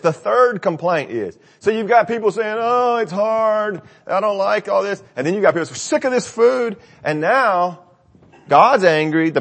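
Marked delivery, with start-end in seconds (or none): 2.6-5.0 high, slow mock whine; whiny complainer. Preacher mimics the grumbling people in a high whine. 5.7-6.8 high nasal-free mock whine; whiny complainer. Preacher voices the people sick of manna, whining high.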